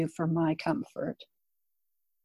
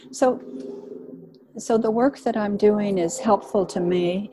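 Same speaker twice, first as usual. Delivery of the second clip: usual speaking voice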